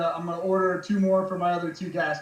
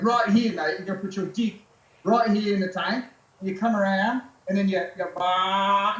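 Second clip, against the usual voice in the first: put-on Australian accent. Aussie riding coach telling a new rider where to sit, in an Australian accent. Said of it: "you do a really good Australian accent".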